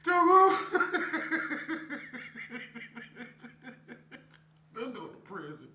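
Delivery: laughing fit, trying to recover; fighting back giggles; real laughter. Real, not acted. Vlogger trying to regain composure, still laughing. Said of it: "Let me get myself together".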